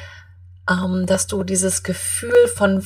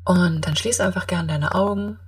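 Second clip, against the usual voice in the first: guided meditation; 9 dB quieter than usual. Acted.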